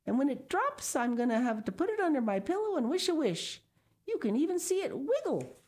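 put-on high, fast, eager child's voice; excited little girl. A narrator's reading. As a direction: rattle it off like an excited kid. Sal speaking to father.

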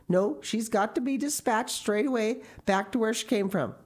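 high, insistent scolding; vehement refusal. A narrator's reading. Marilla orders the girl sent back, vehement. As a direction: lay down the law crossly.

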